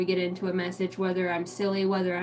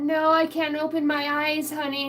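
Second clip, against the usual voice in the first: playful helpless mom; 8 semitones higher, 4 dB louder than usual. Retold or imitated. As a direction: play helpless for a toddler. Plays helpless mother to toddler.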